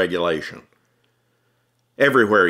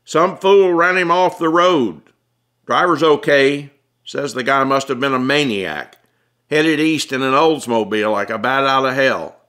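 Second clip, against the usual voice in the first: cop calling out loudly and higher over the fire; raised voice over noise; 5 semitones higher, 4 dB louder than usual. Acted. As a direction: raise your voice to be heard over the flames. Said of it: "raising his voice to be heard".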